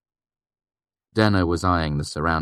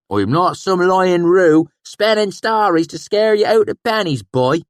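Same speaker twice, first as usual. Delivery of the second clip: irritated swineherd; 14 semitones higher, 9 dB louder than usual. A narrator's reading. Sheem irritated at the narrator's doubt, high and loud. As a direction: put the boy in his place. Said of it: "plainly irritated".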